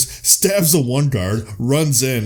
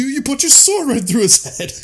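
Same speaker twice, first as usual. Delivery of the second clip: outraged Lord; 13 semitones higher, 3 dB louder than usual. Retold or imitated. The Lord scolding Lancelot over the wedding guests, high indignant squeal. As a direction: squeal it out like a scandalized nobleman. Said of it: "or he's like".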